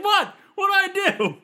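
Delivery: high startled protest, loud and innocent; surprised. Retold or imitated. Shivani answering the yelling wrestler, high startled protest. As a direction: squeak it like she's done nothing wrong. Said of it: "Shivani's like".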